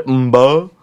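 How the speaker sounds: "mbo" with a hummed m into b; mb sound. Shown as a demonstration. DJ sounding out a tricky title on air. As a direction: hum into "mbo". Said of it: "like the M B sound".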